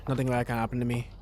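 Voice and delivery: usual speaking voice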